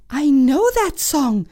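put-on high girly princess voice; a high bratty princess; cartoon princess. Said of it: "said the princess".

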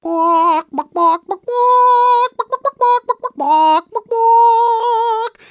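loud, high sung clucks; chicken singing. Acted. Storyteller voicing hen keeping herself awake, clucky singing. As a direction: belt a tune in bawks. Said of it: "she would sing songs".

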